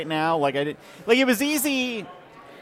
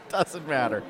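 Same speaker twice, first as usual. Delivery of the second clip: soft, laughing through the words; laughing repeat; 2 semitones lower, 6 dB quieter than usual. Pretend. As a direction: repeat it softly, giving in to a laugh. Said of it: "He says through gritted teeth".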